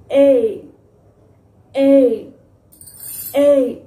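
loud drawn-out ए called out as if to someone far off; ए stretched long and loud, like asking what someone said; phonics sound ai. Shown as a demonstration. Teacher calling out a long ए for children to copy. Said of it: "अभी जोलीफोनिक में इसका sound है और action भी यही है".

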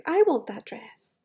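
ordinary delivery

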